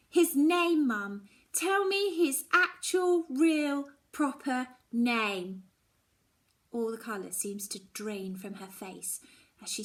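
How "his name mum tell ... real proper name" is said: insistent demand. Acted. Daughter demands name.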